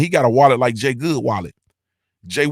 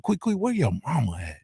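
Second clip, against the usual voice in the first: put-on voice; 6 dB quieter than usual. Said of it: "I gotta put that voice".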